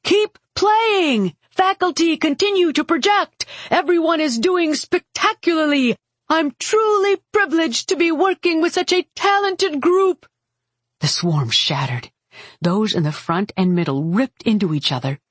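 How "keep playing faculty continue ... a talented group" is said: high, projected, cheery praise; rally the group like a delighted director; upbeat encouragement. A narrator's reading. Mage praising faculty and pipers mid-battle, upbeat high call. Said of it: "his voice upbeat".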